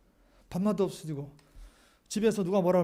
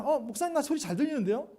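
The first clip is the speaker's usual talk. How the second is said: lightly voiced listener, pitched higher; objecting congregant; 6 semitones higher than usual. Congregant telling the pastor they hear fine, mildly protesting. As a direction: raise the pitch for a puzzled objection.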